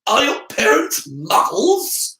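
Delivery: high, slow, probing questions; sudden suspicion. A narrator's reading. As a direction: narrow your eyes and interrogate. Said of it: "with sudden suspicion".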